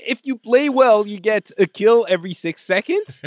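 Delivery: high, disbelieving question; incredulous. Retold or imitated. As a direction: ask it in total disbelief. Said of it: "you're like".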